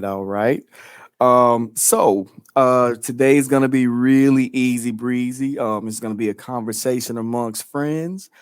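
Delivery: normal talking voice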